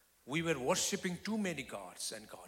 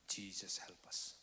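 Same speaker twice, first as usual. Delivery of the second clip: hushed, whispered plea; a quiet desperate whisper to Jesus; simple prayer; 6 semitones lower, 6 dB quieter than usual. Retold or imitated. Husband praying over his sick wife, whispered. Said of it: "I simply said, in my language".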